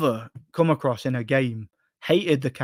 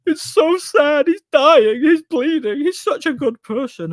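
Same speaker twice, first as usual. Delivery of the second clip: mocking the father; 11 semitones higher, 6 dB louder than usual. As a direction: ham up the grieving father to mock him. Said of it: "And he's like".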